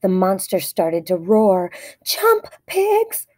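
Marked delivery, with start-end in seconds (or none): 2.0-3.3 high urgent yell; cried out. A narrator's reading. Explorer fleeing monster, urgent high cry to pigs. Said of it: "cried Fluffy the Explorer".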